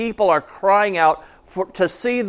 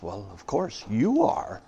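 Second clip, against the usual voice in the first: shuddering in fear; 5 semitones lower, 8 dB quieter than usual. A narrator's reading. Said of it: "shuddering in fear".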